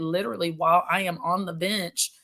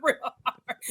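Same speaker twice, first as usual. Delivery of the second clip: laughing through the words, much higher; confessing the failed trick to hosts, laughing; 6 semitones higher, 5 dB louder than usual. Real, not acted. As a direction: giggle out the admission. Laughing about failed trick to not cry.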